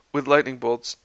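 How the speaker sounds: ordinary delivery, nothing marked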